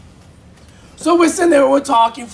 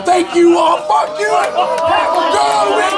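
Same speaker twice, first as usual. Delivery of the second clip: loud sign-off; 7 semitones higher, 4 dB louder than usual. Real, not acted. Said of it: "comic signing off loudly".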